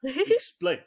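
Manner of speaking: ordinary talk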